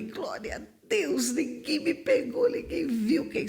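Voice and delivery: exulting as the gate opens; joyful relief. Retold or imitated. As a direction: savour the escape. Relief leaving the checkpoint.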